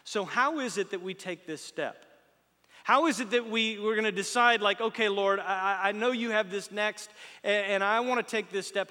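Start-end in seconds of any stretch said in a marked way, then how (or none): 2.8-8.9 loud, driving preacher voice; preaching at full force. Real, not acted. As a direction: push the question out to the crowd.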